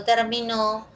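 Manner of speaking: "terminó" stressed on final NÓ; stress on last syllable. Shown as a demonstration. Teacher contrasting accents for students, "terminó" stressed last. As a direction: land hard on the end: ter-mi-NÓ in "terminó". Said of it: "aquí observamos oposición del acento".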